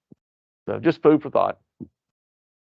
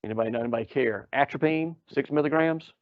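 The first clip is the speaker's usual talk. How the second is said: normal talking voice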